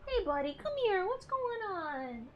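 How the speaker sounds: lightly voiced pet talk; playful baby-talk call to the cat; imitating herself. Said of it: "he went".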